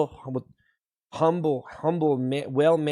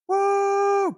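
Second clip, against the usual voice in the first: excited cheer; 14 semitones higher than usual. Acted. Hype cheer.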